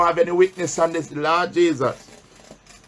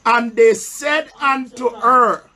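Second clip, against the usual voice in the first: slow, raised reading of the Bible verse; solemn pulpit Bible reading; scripture reading; 5 semitones higher, slower than usual. Acted. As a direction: read the verse slowly to the church, pausing for answers. Preacher reads scripture aloud.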